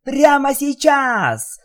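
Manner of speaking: very high, loud, slow squeal; excited shout. Acted. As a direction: yell it out with delight. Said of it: "прокричала розовая пони".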